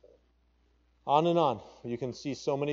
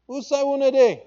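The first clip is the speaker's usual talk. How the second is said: "Usai wunade" called much higher and louder; calling in pidgin; 14 semitones higher, 11 dB louder than usual. Acted. Preacher quoting God in pidgin, a loud high call. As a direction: cry Usai wunade as a longing search. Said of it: "is how we say it in pidgin".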